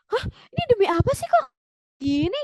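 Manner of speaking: very high incredulous squeal; hammed-up shocked question, rising; disbelief. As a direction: react as if you can't believe this. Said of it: "Kayak nggak nyangka gitu ya".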